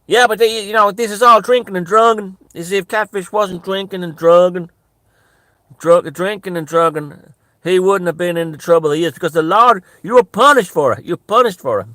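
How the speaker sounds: loud, high-pitched moralizing rant; preachy catfish impression. Catfish blaming drink and drugs for trouble, loud righteous rant. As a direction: cry out the verdict like a scolding believer, repeating for force. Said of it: "That's what he was like".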